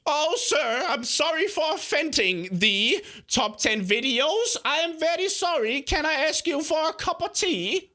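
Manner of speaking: high-pitched mock British imitation; hammed-up British apology, pitched high; terrible British accent. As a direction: put on a badly faked, over-polite British accent. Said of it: "That was the worst British accent".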